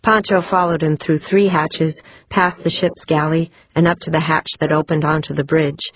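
plain reading voice

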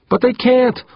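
very high, loud outcry; panicked protest. A narrator's reading. Crew member contradicting captain under fire, high panicked outcry. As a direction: cry out in disbelief, pitch leaping.

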